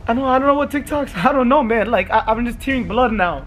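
high, put-on upset voice; mock-traumatized whine; faked distress. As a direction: plead confusion like a shaken crash victim. Faking injured victim to friend.

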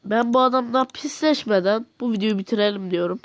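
ordinary talk, nothing marked

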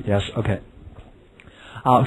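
ordinary talk, nothing marked

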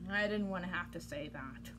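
soft whispered grumble; whispered aside. Real, not acted. Reader complaining to the kids, whispered. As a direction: grumble it under your breath.

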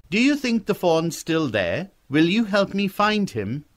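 put-on high boy's voice full of questions; a hopeful boy, pitched well up; eager young boy. Boy asks rider for help finding fawn, high and eager.

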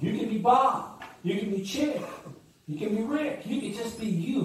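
high, building insistence; rising preacher's excitement. Real, not acted. Preacher urging the congregation, rising high and excited.

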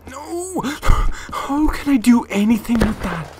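high-pitched, gasping protest; exasperated cry. Real, not acted. Player helpless with bad controller, exasperated cry. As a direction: cry out high, gasp, then protest slowly.

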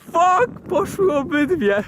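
shouting high and loud in shock; excited shout. Real, not acted. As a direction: yell as a firework goes off overhead. Firework hits him, shouting. (okrzyk zaskoczenia)

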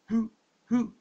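high, stammering alarm; startled, stumbling question; a scared official stammering a question; stammered. A narrator's reading. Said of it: "someone stammered".

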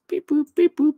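high robotic beep boop noises. Pretend. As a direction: chirp it like a glitching computer. Technical difficulties, mimics computer.

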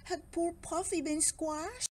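plain reading voice

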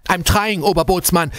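high, strained cry; exhausted sailor crying out; desperate protest. A narrator's reading. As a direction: plead back to the chief in desperation. Wounded sailor answers chief's urgent order.

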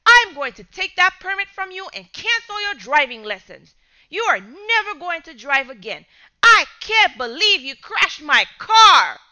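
rising into a loud, high angry shout; furious father. Acted. Father scolding son over crashed car, loud fury. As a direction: build the rage to an outburst.

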